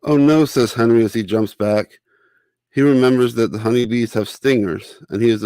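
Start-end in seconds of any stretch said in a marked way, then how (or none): 0.0-0.5 startled. Acted. Character startled by a bee.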